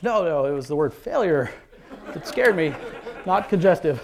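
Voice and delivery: mock alarm, amused and emphatic; laughing protest. Patient's father corrects the doctor's word, laughing protest.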